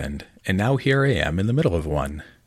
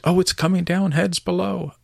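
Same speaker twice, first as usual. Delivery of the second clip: higher shouted warning from the roof; warning yell; 12 semitones higher, 4 dB louder than usual. Acted. Worker on the roof warning the crowd below, shouting. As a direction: call the slate down to those below.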